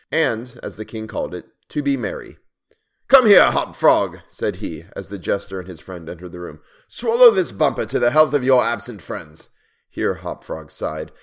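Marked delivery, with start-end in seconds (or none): 3.1-4.2 loud, drawn-out summons; commanding king. A narrator's reading. King summoning his jester as he enters, loud command. 6.9-9.5 loud, raised royal order; commanding king. A narrator's reading. King making the jester drink, loud imperious order.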